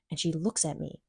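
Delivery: usual speaking voice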